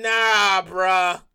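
low, weary brush-off; tired dismissal. Retold or imitated. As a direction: wave it off, worn out. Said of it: "I'm like".

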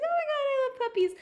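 high, loud and fast gush about puppies; mock gushing interviewer. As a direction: imitate a delighted lady, rushing. Imitating the interviewer lady.

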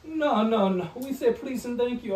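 high, loud scolding as a Jamaican lady; Jamaican lady impression. Jamaican coworker refusing a yelled order, mock scolding. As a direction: imitate a Jamaican lady insisting on manners. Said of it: "she'd be like".

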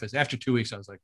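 ordinary delivery

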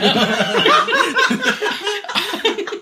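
long, squealing real laughter; high cackling laugh; high-pitched laugh. Real, not acted. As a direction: crack up helplessly, very high. Friends laughing at story.

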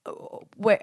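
ordinary talk, nothing marked

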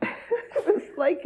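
laughing hard mid-sentence; words breaking into high laughter; can't get it out for laughing. Real, not acted. Laughing at the memory.

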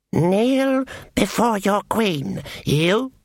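high, slow, cold command; a regal elf queen looking down on her; evil queen. A narrator's reading.

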